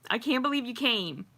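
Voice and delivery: ordinary talk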